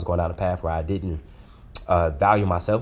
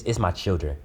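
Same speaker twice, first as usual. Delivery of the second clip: usual speaking voice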